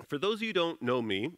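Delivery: ordinary delivery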